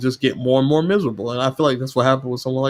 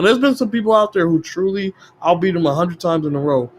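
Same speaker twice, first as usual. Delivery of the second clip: ordinary talk, nothing marked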